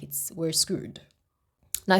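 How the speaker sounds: normal talking voice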